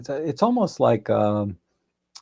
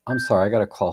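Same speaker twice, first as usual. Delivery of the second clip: ordinary talk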